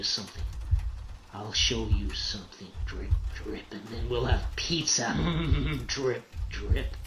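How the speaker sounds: put-on hushed, sinister coaxing to a child; creepy soft menace. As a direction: soften the voice into quiet menace. Father menacing his daughter, sinister.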